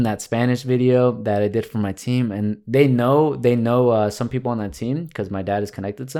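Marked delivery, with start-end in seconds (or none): none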